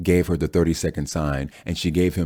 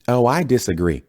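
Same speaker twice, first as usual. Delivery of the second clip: put-on thick Southern accent; 7 semitones higher, 4 dB louder than usual. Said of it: "said in a thick Southern accent".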